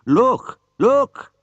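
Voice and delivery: high, bright, eager call; thrilled to spot the old car; excited old man. A narrator's reading. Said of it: "became very excited".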